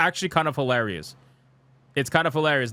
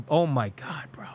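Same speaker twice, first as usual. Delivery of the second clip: stunned breathy whisper, under the breath; whispered oh my God; slower than usual. Real, not acted. Streamer reacting to the AI tweet, whispering in disbelief. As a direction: let it slip out as a whisper.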